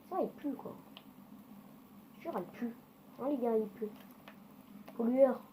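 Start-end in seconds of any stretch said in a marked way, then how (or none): 5.0-5.4 called-out insult. Real, not acted. Insulting another car.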